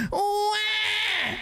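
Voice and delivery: long, high fake baby cry; mock crying wail. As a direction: wail like a mocked crybaby. Mocking PC players crying over exclusives.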